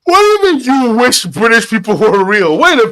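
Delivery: high, indignant wail; outraged outburst. Real, not acted. Answering jibe that British people aren't real, outraged. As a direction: start way up high, stay indignant.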